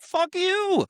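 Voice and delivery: high-pitched mock outrage; angry outburst. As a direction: spit back a hurt, high retort. Acted quarrel over being refused.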